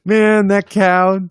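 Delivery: loud slow amused exclaim. Real, not acted. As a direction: marvel at the silly cow. Amused comment.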